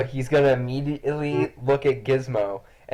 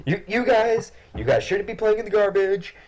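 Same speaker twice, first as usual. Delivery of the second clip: higher, scolding voice; stern dad; 10 semitones higher than usual. Acted. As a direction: scold the cats like a fed-up father. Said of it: "talks sternly".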